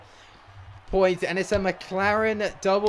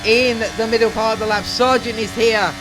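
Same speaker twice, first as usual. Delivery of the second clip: fast-flowing, urgent racing commentary; live play-by-play; 3 semitones higher, 3 dB louder than usual. Real, not acted. Gamer calling his own final-lap battle to viewers, excited play-by-play. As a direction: call the race live, raised and driving.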